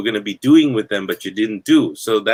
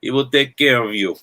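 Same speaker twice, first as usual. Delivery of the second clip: put-on Italian accent; 4 semitones higher than usual. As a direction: ham up a shaky Italian mafia accent that slides toward Bulgarian. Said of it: "that didn't even sound Italian".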